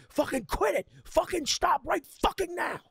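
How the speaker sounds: high, loud furious yell at a little brother; big retold shout, high and loud. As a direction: scream it at him, fed up. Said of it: "yelled and was like".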